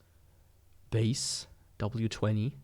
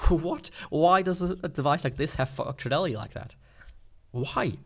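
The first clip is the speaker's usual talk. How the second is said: incredulous, can't believe the feature exists; surprised disbelief; 4 semitones higher than usual. Real, not acted. Reviewer baffled that a cheap player shares files, surprised. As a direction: pitch jumps up, a baffled what.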